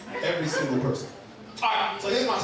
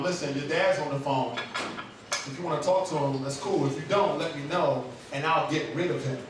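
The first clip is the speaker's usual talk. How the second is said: low, calm motherly voice; quietly composed mom, lower and understated; peaceful mom; 6 semitones lower, 4 dB quieter than usual. Retold or imitated. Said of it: "acts real peaceful about it".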